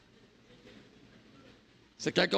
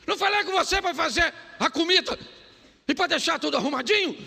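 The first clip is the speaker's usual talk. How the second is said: high, loud nagging at her husband; scolding wife; 8 semitones higher, 5 dB louder, faster than usual. Retold or imitated. Wife scolding husband on his phone, high and loud. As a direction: demand it like an angry wife. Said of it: "Nesse tom".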